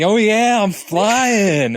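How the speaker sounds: high, loud gleeful cry; hammed-up happy bird; excited bird. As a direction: soar with joy. Retelling Kuzco as happy bird.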